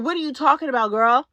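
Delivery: usual speaking voice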